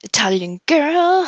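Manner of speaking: usual speaking voice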